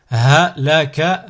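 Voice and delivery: slow, each syllable drawn out separately; split into syllables. Shown as a demonstration. Teacher models a word for learners to repeat, split into syllables. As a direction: cut the word up, pausing between syllables. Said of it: "décomposer le mot".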